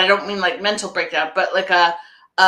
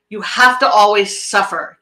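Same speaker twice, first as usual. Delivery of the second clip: ordinary delivery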